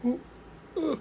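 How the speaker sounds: stifled yawn. Real, not acted. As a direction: yawn slipping out mid-sentence. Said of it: "I hope y'all didn't catch that yawn".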